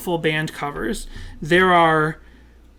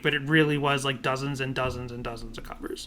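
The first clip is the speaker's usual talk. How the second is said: much quieter, lower voice; hushed; 4 semitones lower, 8 dB quieter than usual. Real, not acted. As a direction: drop your volume right down for the neighbours. Lowers voice after being shushed.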